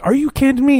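high-pitched imitation of disbelief; mock incredulous. Dixon objecting to the release order, incredulous. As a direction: voice leaps up in mock outrage. Dixon's outraged reply, acted.

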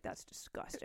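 whispered in mock disgust. Imagining hearing the chewing on a podcast, a disgusted whisper. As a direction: breathe it out, grossed out. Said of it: "I'd be like".

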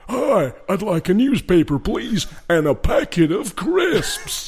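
high, over-projected presenter voice; hammed-up TV presenter, stretching the final words; David Garrido. Presenter ordering in a shop, exaggerated broadcast voice. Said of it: "always talks in the presenter's voice".

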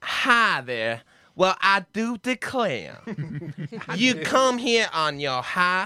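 put-on slow, swooping Southern belle accent; regional American accent. Comic practising accents on air, theatrical American South accent. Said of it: "trying to do regional American accents".

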